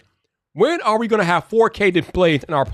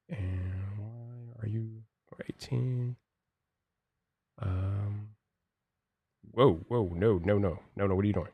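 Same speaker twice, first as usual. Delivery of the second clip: very soft, low self-talk; barely voiced troubleshooting aside; distracted muttering; 6 semitones lower, 11 dB quieter than usual. Real, not acted. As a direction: mutter while busy fixing something. Mutters to himself while adjusting stream.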